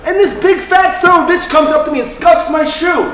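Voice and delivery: high, loud and worked up; loud, aggrieved complaint; indignant outburst. Acted. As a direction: rant with outrage. Indignant account of a street quarrel.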